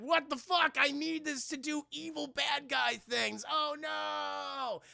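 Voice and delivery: high, mock-distressed villain wail; hammed-up villain dismay. Acting the thwarted villain's dismay.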